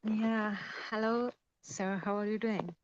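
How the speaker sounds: ordinary talk, nothing marked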